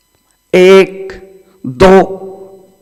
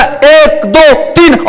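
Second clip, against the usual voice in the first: mock tearful counting; 6 semitones higher, much slower than usual. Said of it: "फूट के रोने लग गया".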